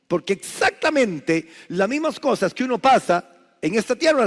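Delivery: loud, high fervent pulpit delivery; preaching at full force. Acted. Pastor stirring the congregation, full-force preaching.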